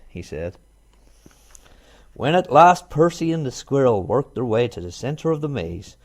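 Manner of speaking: ordinary delivery, nothing marked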